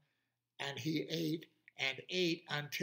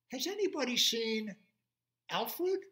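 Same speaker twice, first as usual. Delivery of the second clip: lion voice; 9 semitones higher, 6 dB louder than usual. Acted. Lion asks.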